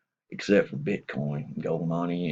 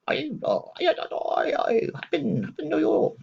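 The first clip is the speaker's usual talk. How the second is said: high, strained put-on voice of the doctor; mock doctor voice. The doctor greeting the patient in a high mock voice. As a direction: squeeze the voice up high to play him. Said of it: "and he goes".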